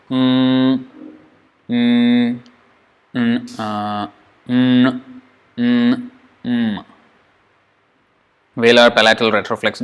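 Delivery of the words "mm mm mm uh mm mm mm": series of short hums through the nose; nasal hums. Shown as a demonstration. Teacher demonstrating the nasal series with soft hums. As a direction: hum each nasal softly. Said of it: "All the nasal sounds happen".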